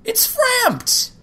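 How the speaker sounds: excited. Real, not acted. Spots a familiar character.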